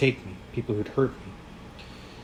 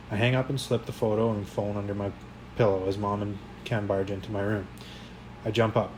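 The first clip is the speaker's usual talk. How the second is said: usual speaking voice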